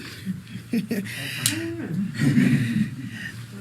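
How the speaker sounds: quiet chuckle fading out; soft laugh. Real, not acted. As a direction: laugh at your own joke. Laughs after own joke.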